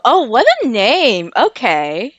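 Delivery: ordinary talk, nothing marked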